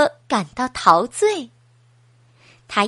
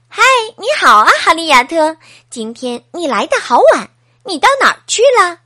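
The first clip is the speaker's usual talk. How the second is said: much louder, higher and fast; excited greeting; 4 semitones higher, 7 dB louder, faster than usual. Acted. 艾弗兴奋地迎接迟到的哈利亚特，又快又响. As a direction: burst out cheerfully to a late friend. Friend greets late hedgehog.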